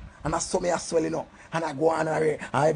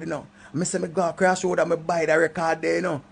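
usual speaking voice

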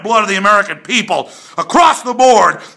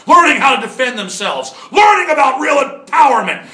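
rising angry shout; 7 semitones higher than usual. Real, not acted. Ranting at the media.

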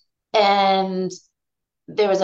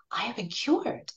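whispered, soft and reverent; hushed whisper; 9 dB quieter than usual. Retold or imitated. Retelling Marta's return from the doctor, an unvoiced whisper. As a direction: breathe the words like a secret.

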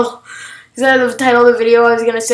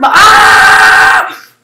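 startled scream; 8 semitones higher, 7 dB louder than usual. Real, not acted. Startled in game.

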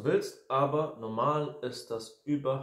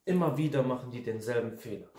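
normal talking voice

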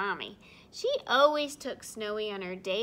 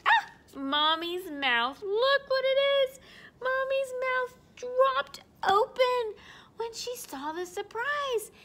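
amazed; 12 semitones higher, 8 dB louder than usual. Acted. Storyteller shows amazement.

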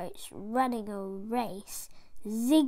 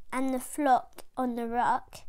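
ordinary delivery, nothing marked

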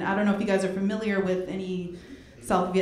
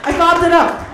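exclaiming; 6 semitones higher, 8 dB louder than usual. Real, not acted. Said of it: "That's what happened there".

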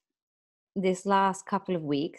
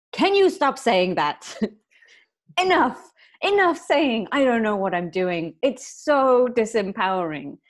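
louder, higher, fed-up pleading; exasperated partner; 5 semitones higher, 6 dB louder than usual. Retold or imitated. Partner telling her to quit the self-doubt, exasperated. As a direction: throw up your hands at her repeating it. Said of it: "not this animated either".

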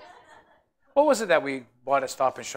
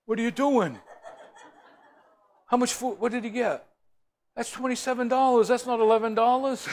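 mock owner's protest; 9 semitones higher than usual. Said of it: "Louis come out and goes".

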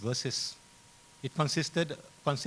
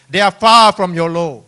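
loud, high pulpit voice; ringing, raised preaching delivery; forceful scripture proclamation; 5 semitones higher, 19 dB louder than usual. Acted. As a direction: declaim the verse to the congregation. Proclaims the quoted verse.

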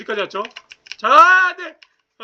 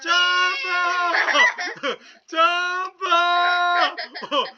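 laughing singsong; 7 semitones higher, 3 dB louder, much slower than usual. Real, not acted. Laughing, drawn-out jump calls.

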